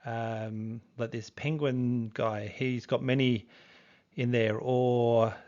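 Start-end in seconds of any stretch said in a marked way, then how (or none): none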